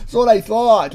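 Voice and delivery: loud, high-pitched mocking quote; hammed-up imitation of his protest; mock excuse. Imitating what he says when caught, loud high mock voice.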